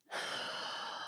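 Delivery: long, soft breath out; shaky deep sigh. Retold or imitated. Toddler copying the calming breath, deep sigh. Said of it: "she went".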